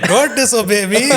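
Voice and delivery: put-on comic Indian-accented command. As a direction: repeat the scolding in a hammed-up Indian accent. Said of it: "in an Indian accent".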